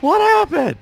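imitating mom's loud, high cry; shrill-pitched mock panic, shouted as mom; alarmed mom. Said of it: "my mom was like".

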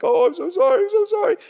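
high, whiny mock child pleading sorry; exaggerated childish sorry; whiny kid apology. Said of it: "Your kids like that. They're just like".

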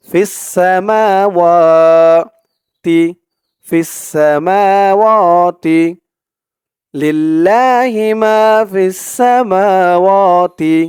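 ফিস সামাওয়াতি with each long vowel held one alif; slow melodic recitation; stretched vowels. Shown as a demonstration. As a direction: recite সামাওয়াতি slowly, drawing out মা and ওয়া. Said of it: "এক আলিফ করে টান".

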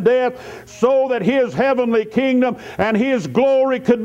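high-pitched, insistent sermon delivery; fervent preaching. Acted. Preacher telling congregation God saved them, fervent. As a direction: press the message home with drawn-out key words.

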